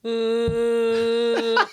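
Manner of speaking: loud grunting dinosaur roar; ankylosaurus roar. Pretend. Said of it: "That was definitely an ankylosaurus".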